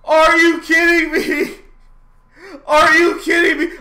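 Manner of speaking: raging gamer yelling at the game; frustrated yelling. Real, not acted. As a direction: scream at the screen in outrage. Horse lost, mission reset.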